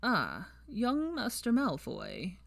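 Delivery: put-on drawn-out, miserly old man; old shopkeeper. A narrator's reading.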